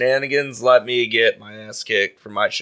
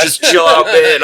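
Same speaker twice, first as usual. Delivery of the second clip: louder, higher retold protest; imitated exasperated plea; 4 semitones higher, 8 dB louder, slower than usual. Man telling a heckler to stop, imitated exasperation. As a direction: plead loudly as the annoyed boyfriend.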